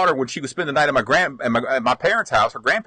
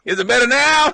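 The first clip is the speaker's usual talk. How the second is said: louder, higher call from the roof; 8 semitones higher, 5 dB louder than usual. Retold or imitated. Dad on the roof calling to mom, loud and high. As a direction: shout down asking about the picture. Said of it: "He's moving around".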